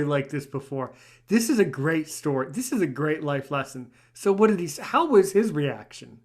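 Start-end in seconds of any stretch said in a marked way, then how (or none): none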